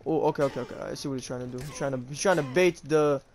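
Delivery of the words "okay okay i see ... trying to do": usual speaking voice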